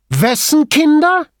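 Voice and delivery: very high, loud, slow challenge; Grifoni, offended, challenges him loudly; indignant. A narrator's reading. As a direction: demand it, outraged.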